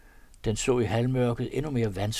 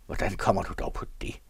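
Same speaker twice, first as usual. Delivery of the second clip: gruff question; faster than usual. A narrator's reading. Valse Peter questions his companion, gruffly. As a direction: ask it gruffly without raising the voice. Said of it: "spurgte Valse Peter bøst".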